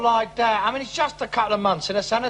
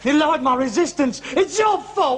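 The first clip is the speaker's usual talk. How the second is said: accusing shout; 5 semitones higher than usual. Acted. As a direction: throw the blame in his face. Son blames father in row.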